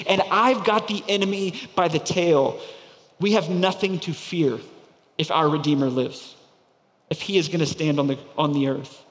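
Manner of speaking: ordinary talk, nothing marked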